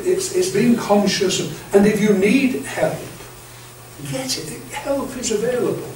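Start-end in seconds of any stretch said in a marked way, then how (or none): none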